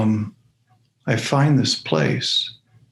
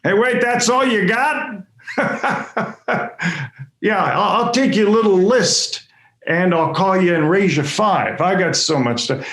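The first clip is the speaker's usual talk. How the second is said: much higher, teasing call from the back of the room; voice yelling from the back; 7 semitones higher than usual. Retold or imitated. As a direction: call out across the room, playfully topping him. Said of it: "that yells out".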